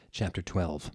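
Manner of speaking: normal talking voice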